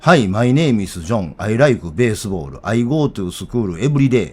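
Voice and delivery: slow schoolbook English in Kansai intonation; Kansai-accented English. Shown as a demonstration. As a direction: chant it slowly like an Osaka textbook drill, Kansai accent. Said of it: "俺の英語全部関西イントネーションですよ".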